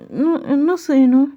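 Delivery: high small voice; eager childlike. Acted. Jungkook asking the mother what he must do, high childlike voice. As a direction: ask eagerly like a kid.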